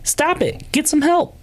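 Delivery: high, fed-up stop it; exasperated retort. Retold or imitated. Scolding those who allow trafficking, high and fed up.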